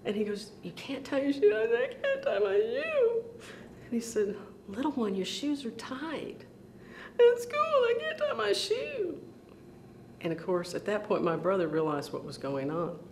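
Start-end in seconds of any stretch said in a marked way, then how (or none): 0.6-1.6 higher-pitched, rising query; puzzled question. Retold or imitated. Brother questioning his crying little sister. 1.8-3.2 slow, very high mock wail; crying and wailing. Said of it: "I was crying and crying". 4.7-6.4 softer, higher, coaxing voice; gentle big brother. Retold or imitated. Brother soothing his crying little sister. 7.2-9.2 very high, squeaky mock wail; crying and wailing. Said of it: "I was crying and crying".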